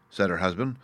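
usual speaking voice

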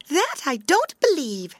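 high, bright sunshine voice; a cheerful sun disagreeing; warm beaming sun voice. Acted. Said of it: "said the sunshine".

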